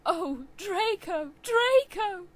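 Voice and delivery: loud, high, slow mock scream of a name; hammed-up scream. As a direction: wail the name theatrically. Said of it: "I screamed while getting an orgasm".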